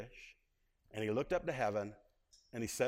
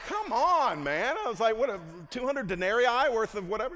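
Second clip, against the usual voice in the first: loud, much higher, exasperated come on man; mock outraged protest; 8 semitones higher, 9 dB louder than usual. Preacher retelling his protest to his boss, loud mock outrage. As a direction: play up the disbelief, big and loud.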